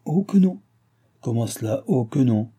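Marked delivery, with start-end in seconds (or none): 0.0-0.6 high, insistent contradiction; assured refusal. A narrator's reading. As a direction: repeat the refusal with full confidence. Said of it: "avec la même assurance".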